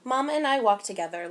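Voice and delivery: usual speaking voice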